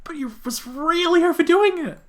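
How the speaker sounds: distressed heroine. Acted. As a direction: panic over his bleeding wounds. Character panics over wounds.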